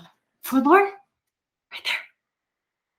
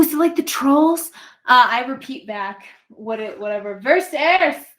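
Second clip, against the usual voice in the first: ordinary talk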